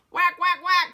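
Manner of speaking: mother duck crying after her babies; mama duck cry. Acted. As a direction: quack in alarm. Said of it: "she cried".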